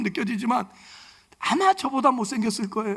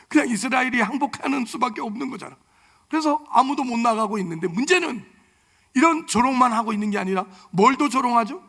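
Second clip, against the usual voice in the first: ordinary delivery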